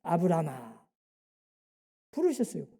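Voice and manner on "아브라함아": God calling Abraham. Acted. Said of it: "아브라함을 불러냈어요".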